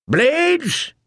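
high sharp battle shout; rally the men. A narrator's reading. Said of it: "Yoren shouted".

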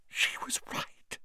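whispered, voiceless echo of "She was right.". Acted. Lavender whispering "She was right." to herself. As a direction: breathe "She was right." as a hushed aside.

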